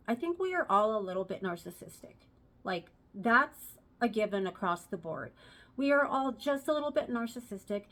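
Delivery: ordinary talk, nothing marked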